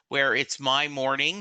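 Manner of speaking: usual speaking voice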